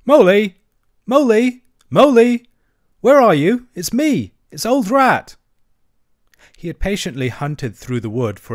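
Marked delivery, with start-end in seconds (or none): 0.0-5.4 high, loud cheerful shouts; cheerful calling. A narrator's reading. Rat searching the wood for his friend, cheerful loud calls. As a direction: call out brightly into the dark wood. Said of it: "all the time calling out cheerfully".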